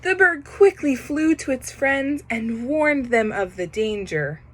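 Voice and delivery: plain reading voice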